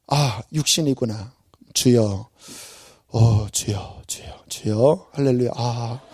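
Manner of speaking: low, slow murmured Lord Lord; muttered prayer. Retold or imitated. Preacher reenacts praying in traffic, quiet and low. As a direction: pray under your breath to calm down. Praying while driving angry.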